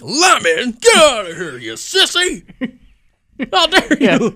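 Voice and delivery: high, loud, deeply insulted huff; mock-offended shriek, laughing at the end; offended person. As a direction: scoff as if lemon on fish were an outrage. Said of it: "supposed to be an offended person".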